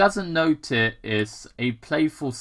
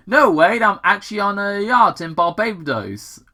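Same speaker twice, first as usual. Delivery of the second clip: higher, louder mock-boastful joke; a jokey brag, higher; joking; 8 semitones higher, 5 dB louder than usual. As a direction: kid your deputy over the radio. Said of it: "answers back in a joking manner".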